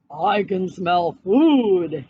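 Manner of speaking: low, slow hungry bear calling out; a hungry bear calls from the trees, slow and low. A narrator's reading. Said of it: "yelled a voice from nearby".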